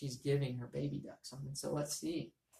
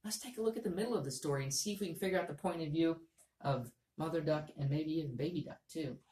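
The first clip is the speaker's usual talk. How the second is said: ordinary talk, nothing marked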